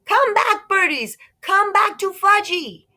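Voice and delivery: put-on very high, loud child wail; toddler screaming. Fudge screaming at the pigeons, high loud toddler wail. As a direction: scream after the birds like a little kid. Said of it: "was screaming".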